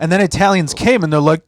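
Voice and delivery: ordinary delivery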